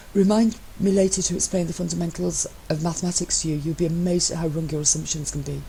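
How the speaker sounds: lightly voiced teasing husband; a hint of smug, playful partner; lightly voiced cheeky spouse; Matt's voice. Matt's voice in the reading.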